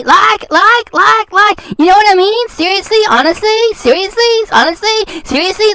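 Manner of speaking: imitating her endless uptalk, filler words and all; mock upward inflection. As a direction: parody a vapid valley-girl uptalk. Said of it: "Everything's with an upward inflection".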